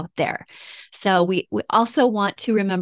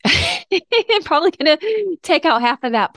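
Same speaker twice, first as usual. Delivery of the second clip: teary laugh; 9 semitones higher, 4 dB louder than usual. Real, not acted. Host recovering after a long emotional pause, laughing. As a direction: laugh while pulling yourself together. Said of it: "to try to pull it together here".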